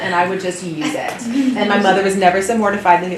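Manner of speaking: ordinary talk, nothing marked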